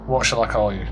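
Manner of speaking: ordinary talk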